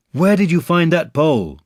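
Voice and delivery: put-on a curious boy asking Pooh; Christopher Robin. Christopher Robin asks Pooh about the pole, a lightly voiced boy.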